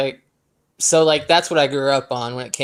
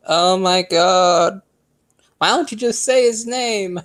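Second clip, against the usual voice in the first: exasperated plea, pitched up; mock exasperation; 4 semitones higher than usual. Podcast host voicing frustration at evasive conference callers, exasperated. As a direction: draw out the disbelief, then plead higher. Retold reaction to evasive call.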